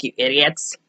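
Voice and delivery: a hint of a disgusted cleaning lady; mock glare. Cleaning lady glaring at them.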